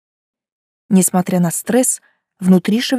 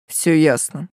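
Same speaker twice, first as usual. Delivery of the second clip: disgusted; 4 semitones lower than usual. Acted. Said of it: "брезгливо подвёл итог Гелиан".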